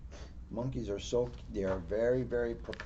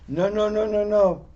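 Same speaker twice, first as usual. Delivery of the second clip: playful refusal; 6 semitones higher, 9 dB louder than usual. Pretend. Listener joins the monkeys' refusal.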